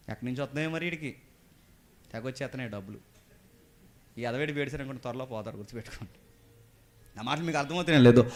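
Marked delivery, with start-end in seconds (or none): none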